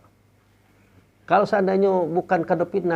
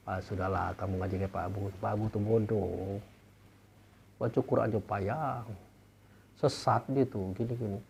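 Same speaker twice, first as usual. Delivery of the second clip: mock dismissive gossip; 8 semitones lower, 10 dB quieter than usual. Preacher imitating people who belittle his teaching, mock dismissive. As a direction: imitate people quietly running someone down.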